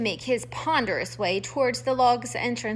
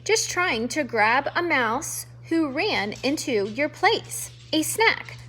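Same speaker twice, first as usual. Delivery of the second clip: plain reading voice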